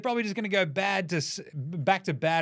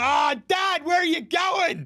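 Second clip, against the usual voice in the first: whiny Bronny; 10 semitones higher, 3 dB louder than usual. Retold or imitated. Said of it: "You're just like".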